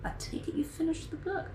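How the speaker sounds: neighbor's question in a high whisper; whispered neighbor quote. Retold or imitated. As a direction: hush it like a gentle neighbor. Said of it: "And he was like".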